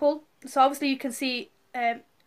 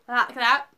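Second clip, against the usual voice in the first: pained sigh; 5 dB louder than usual. Retold or imitated. Showing breathing through contraction.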